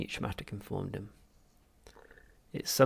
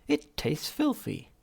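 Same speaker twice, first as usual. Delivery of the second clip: slow, disgusted complaint; disgusted retort; 6 semitones higher, 5 dB louder, slower than usual. Acted. Arthur answering the machine, slow disgust. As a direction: spit out your disgust slowly.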